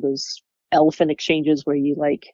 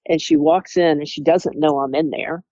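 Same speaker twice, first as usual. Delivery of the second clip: ordinary talk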